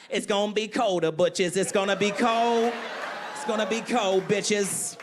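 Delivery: comic impression, loudly announcing the forecast; brash mock weather warning, words stretched; Cat Williams. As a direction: pop out and proclaim it like a street herald. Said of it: "Cat Williams called me up".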